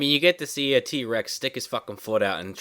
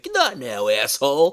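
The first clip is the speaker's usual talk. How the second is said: acted exasperated outburst, raised pitch; mock-angry, pitched up as you tell someone off; irritated brush-off; 3 semitones higher, 3 dB louder than usual. Acted quarrel, telling friend off.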